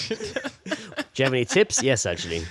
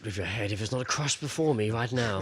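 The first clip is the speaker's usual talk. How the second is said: mock barbarian threat; 6 dB quieter than usual. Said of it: "pretending to be dickheads".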